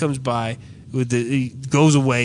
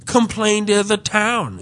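higher, drawn-out canned reply; mock postal clerk; 5 semitones higher than usual. Clerks sending complainers off to the town, mock-official. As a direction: imitate an official repeating the stock answer. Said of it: "is what they told the guys".

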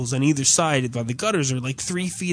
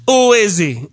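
put-on loud, higher Caribbean-accented demand; Caribbean clerk; 5 semitones higher, 7 dB louder than usual. Clerk demanding who the other addressee is, loud Caribbean imitation. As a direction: imitate a gravely concerned Caribbean clerk, loudly. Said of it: "like, Caribbean dude who's kinda".

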